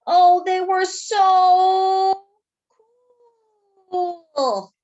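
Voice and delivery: drawn-out, loud, high gushing "so cool"; awed gush. A narrator's reading. Narrator in awe of the cool beans, gushing. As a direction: swoon over how cool they are.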